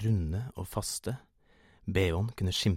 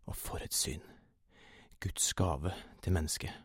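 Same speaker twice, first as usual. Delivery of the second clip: soft whispered marvel; awed hush; 4 dB quieter than usual. A narrator's reading. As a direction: marvel under the breath. Narrator marvels to himself. (dempet, hviskende undring)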